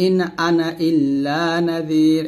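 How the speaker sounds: slow recited ana with short vowel, no stretch; Quranic recitation. Shown as a demonstration. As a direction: recite it slowly without lengthening. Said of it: "এই আনাতে টান দেওয়া যাবে না".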